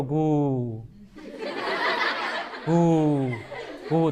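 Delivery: low hooting owl call; deep comic owl hoots. Acted. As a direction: hoot like an owl, drawn out. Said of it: "ઘૂઘવાટો કર્યો".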